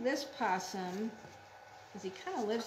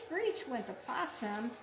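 ordinary delivery

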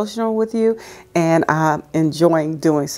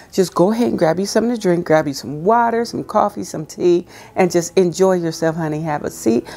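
ordinary talk, nothing marked

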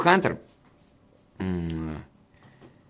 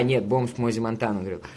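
ordinary talk

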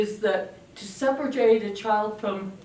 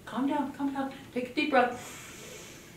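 soothing; 3 semitones higher than usual. Acted. Soothing the angry puppet.

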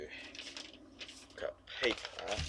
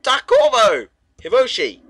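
calling out; 12 semitones higher, 15 dB louder than usual. Pretend. Characters reunite in game.

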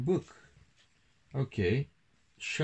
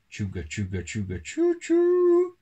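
chugging that rises to a loud, high choo-choo; train imitation; 7 dB louder than usual. A narrator's reading. Reader imitating a departing train for a child, chugging and hooting. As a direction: chug along, then hoot high and loud.

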